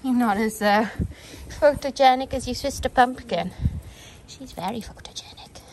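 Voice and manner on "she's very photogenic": hushed unvoiced aside; whispering. Real, not acted. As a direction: whisper it softly.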